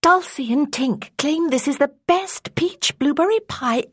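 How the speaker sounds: high, loud, breathy fairy voice. Acted. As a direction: lift it up into a tiny fairy. Fairy speaks to Mother Dove.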